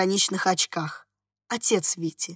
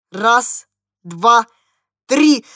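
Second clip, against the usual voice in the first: commanding count; 6 dB louder, much slower than usual. A narrator's reading. Father counting for his son to jump, mounting command. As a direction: separate counts, each more forceful.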